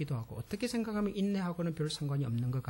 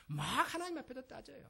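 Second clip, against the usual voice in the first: ordinary delivery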